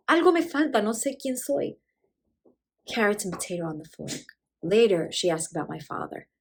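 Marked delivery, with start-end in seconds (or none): 0.1-1.8 put-on confused old mother. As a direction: lightly act a mother who feels lost. Confused mother answers daughter.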